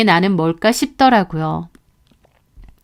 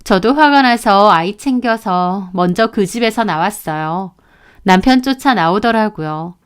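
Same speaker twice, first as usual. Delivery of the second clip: ordinary delivery, nothing marked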